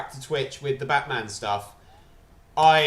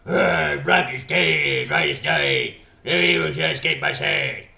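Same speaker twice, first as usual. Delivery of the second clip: loud, distorted superhero voice; put-on heroic chant, loud and strained; Green Lantern; 4 dB louder than usual. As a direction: declaim an oath in a forced, rough voice. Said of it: "shall I use my Green Lantern voice".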